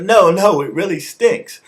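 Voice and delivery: high, loud put-on protest; mock insistence. Rollins contradicting Kane in retelling, mock insistent protest. Acted back-and-forth argument over stink.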